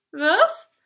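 usual speaking voice

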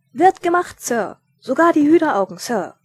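loud, high shout of a zealous recruit; over-eager parade-ground reply, each "Sir" dropping; military acknowledgement. A narrator's reading. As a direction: shout it like a soldier reporting for duty. Subordinate snaps out reply to order.

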